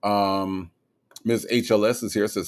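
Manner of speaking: ordinary delivery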